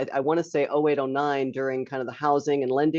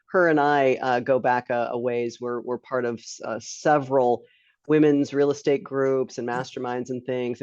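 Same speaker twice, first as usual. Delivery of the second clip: ordinary talk, nothing marked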